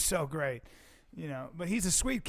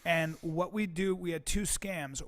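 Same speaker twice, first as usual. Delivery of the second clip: ordinary talk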